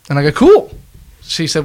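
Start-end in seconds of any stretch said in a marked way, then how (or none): 0.3-0.7 high, louder "cool"; acted upbeat reply. Man answering a new acquaintance, acted upbeat reply. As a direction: pop the pitch right up on a one-word reply. Acts out his reply to Sarah.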